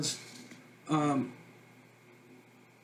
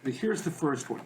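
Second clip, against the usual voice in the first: ordinary talk, nothing marked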